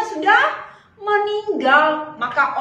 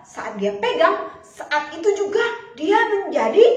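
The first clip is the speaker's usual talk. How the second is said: usual speaking voice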